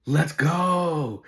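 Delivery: loud, high cheer of triumph; elated shout. Retold or imitated. Son reading his transfer letter, elated shout. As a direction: burst out cheering at great news. Son's reaction to transfer news.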